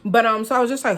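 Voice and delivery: ordinary delivery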